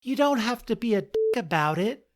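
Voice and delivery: high, fast squeaky mouse; indignant little squeak. Acted. Mouse scolding the laughing lion, high quick squeak. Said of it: "said the mouse".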